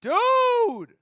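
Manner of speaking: very high, loud drawn-out dude; exasperated yell. Real, not acted. Streamer exasperated at the announcement, loud drawn-out yell. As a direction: stretch it out in exasperation.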